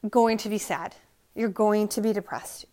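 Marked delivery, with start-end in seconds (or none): none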